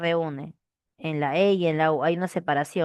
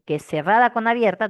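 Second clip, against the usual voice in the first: ordinary talk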